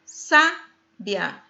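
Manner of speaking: "sábia" split into SÁ, pause, bia; syllable by syllable. Shown as a demonstration. Teacher counting syllables for learners, "sábia" split after SÁ. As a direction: break "sábia" after a stressed SÁ. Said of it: "Paroxítona terminada em A".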